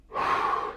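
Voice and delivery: breathy release sigh after effort; exhaled sigh of release. Real, not acted. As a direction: let the breath go.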